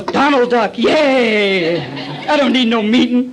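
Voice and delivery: loud, cocky outburst; drunken triumph. Acted. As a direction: shout it out like a tipsy armchair winner. Acting himself drunk at Jeopardy.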